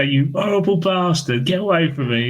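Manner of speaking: mock outrage. Retells outraged protester's reply.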